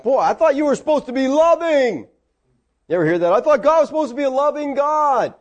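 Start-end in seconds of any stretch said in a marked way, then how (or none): 0.0-2.1 high-pitched, put-out protest; acted indignant grumbling, pitched up; mock complaint. Mimics believers complaining when refused. 3.3-5.3 high-pitched aggrieved protest; acted indignant objection, pitched up; mock complaint. Mimics a common complaint about God.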